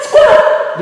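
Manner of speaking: very high squawked quack; duck quack. Shown as a demonstration. Teacher showing how a duck quacks, high squawk. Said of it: "Squat like".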